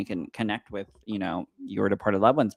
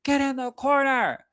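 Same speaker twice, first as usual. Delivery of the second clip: stern parent. Retold or imitated. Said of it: "I've never had to been like".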